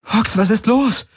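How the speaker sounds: very high, frightened question; startled high-pitched voice; alarmed. A narrator's reading. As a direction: ask in sudden alarm. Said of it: "fragte Kirai erschrocken".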